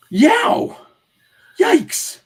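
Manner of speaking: high, loud cartoon yelps; acted shriek of fright; startled yelp. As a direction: cry out as if jolted awake. Startled cries in acted comic reading.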